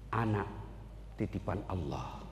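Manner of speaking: soft and low; quiet acceptance. Acted. Preacher as Ayub softly accepting his children's loss. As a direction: let it go gently.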